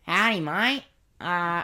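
hoge, overdreven Aussie kerel; Australian accent. Acted. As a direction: speel een joviale Australiër die stopt. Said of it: "Australian guy".

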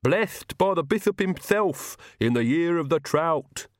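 slow, high-pitched lisping servant voice; odd, lisping henchman proclaiming; Igor, the servant. A narrator's reading.

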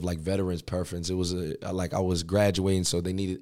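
usual speaking voice

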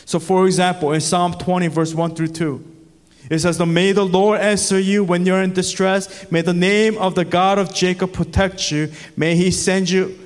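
ringing pulpit voice, climbing with each book; preaching at full force. Acted. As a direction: lift it into a rising sermon climax.

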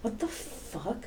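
slow, soft retold bewilderment; hushed disbelief. Teacher baffled by a student's warning, hushed disbelief. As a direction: stretch it out quietly, as if to yourself.

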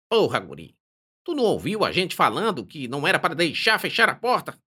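complaining. Acted. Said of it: "O primeiro deles reclama".

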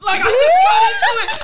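cackling loud; laughing shout. Real, not acted. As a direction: yell it through laughter.

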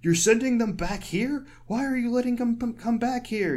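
imitating a panicked husband, high-pitched protest; high, incredulous mock alarm; retold freak-out. Husband protesting to ground control, mock freak-out. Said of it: "He's, like, freaking out".

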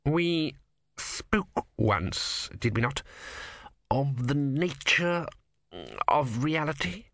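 slow and weighty, long pauses; heavy, slow words. A narrator's reading. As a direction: drag every word up from the depths. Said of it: "as if every word was being winched up from a great depth".

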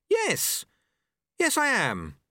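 a startled novice stammering; nervous boy. A narrator's reading. Apprentice answers his master, nervous. Lobsang voiced.

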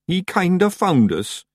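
high, slow Scots Feegle voice; Daft Woolly. A narrator's reading. Feegle explaining the cheese to the witch, high slow Scots. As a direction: explain sheepishly as a little Scottish creature.

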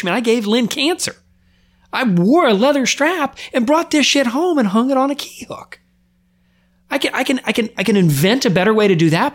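pained, pushing out the guilt in real distress; anguished outburst. Real, not acted. Blaming himself for his wife's cancer, raw anguished pitch.

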